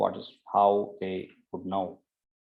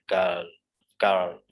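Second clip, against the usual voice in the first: usual speaking voice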